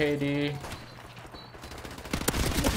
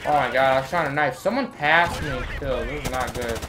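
ordinary talk

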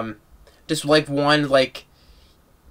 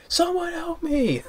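imitated scream; 5 dB quieter, slower than usual. Comparing the two films, a high mock scream. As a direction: pitch it way up and drag it. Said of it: "just way he screams".

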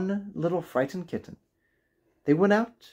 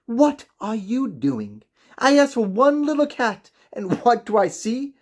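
high, half-laughing disbelief; dismayed outcry; 9 semitones higher, 3 dB louder than usual. A narrator's reading. Old woman scolding her husband about the cats, high and aghast. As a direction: exclaim in amused dismay. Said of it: "she cried".

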